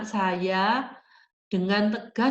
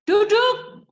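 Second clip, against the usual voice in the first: high, loud yelled order; shouted command; 12 semitones higher, 8 dB louder than usual. Shown as a demonstration. Teacher showing parents what is no longer firm, shouting "sit" high and loud. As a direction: yell it sharply, well above talking pitch. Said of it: "itu nggak tegas lagi".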